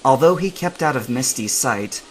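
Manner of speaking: usual speaking voice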